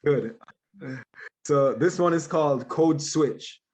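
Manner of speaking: ordinary talk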